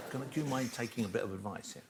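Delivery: hushed confidential whisper. Acted. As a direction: lean in and whisper the advice. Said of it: "Why are you whispering?".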